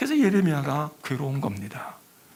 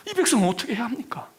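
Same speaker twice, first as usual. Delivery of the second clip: pleading lament; 7 semitones higher, faster than usual. Retold or imitated. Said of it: "탄식소리를 낸다".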